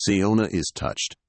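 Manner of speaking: ordinary delivery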